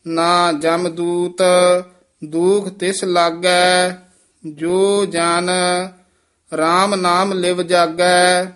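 measured, sustained Gurbani recitation; scripture recitation. Shown as a demonstration. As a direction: recite phrase by phrase, holding the endings.

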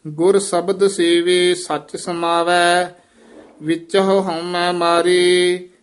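measured, drawn-out devotional chant; scripture recitation. Shown as a demonstration. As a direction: recite steadily, holding the line endings. (ਠਹਿਰਾਅ ਵਾਲਾ ਗੁਰਬਾਣੀ ਪਾਠ)

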